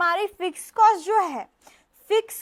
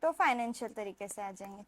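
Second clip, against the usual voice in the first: dull monotone; 6 semitones lower, 7 dB quieter, faster than usual. Shown as a demonstration. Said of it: "ऐसे पढ़ेंगे तो bore होगा".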